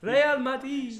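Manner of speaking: put-on hammy Spanish accent on "Real Madrid". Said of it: "Say Real Madrid in the Spanish accent".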